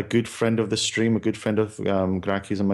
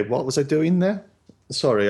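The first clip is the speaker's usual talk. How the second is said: ordinary delivery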